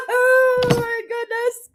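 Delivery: slow, high words stretched by laughter; helpless laughing exclamation. Real, not acted. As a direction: say it through real giggles. Laughing fit over screenshot.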